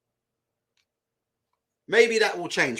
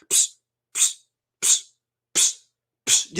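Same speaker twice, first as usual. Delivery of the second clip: sharp breathing hisses through the teeth; slower than usual. Shown as a demonstration. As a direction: exhale in short puffs like a fighter. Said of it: "You don't hear any of this, like".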